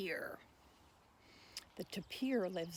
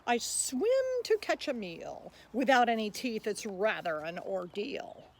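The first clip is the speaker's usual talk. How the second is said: louder, bouncy rhyming voice of the tapir; tapir reciting; 8 dB louder than usual. Acted. Tapir pleading with listeners to be saved, lively recital. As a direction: speak as the friendly tapir asking for help. Whale speaks its poem.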